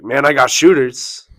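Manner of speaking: mock threat. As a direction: boast a threat like the rival, mockingly. Rival threatens gun violence.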